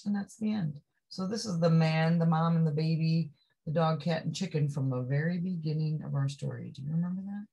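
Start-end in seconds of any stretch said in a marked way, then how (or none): none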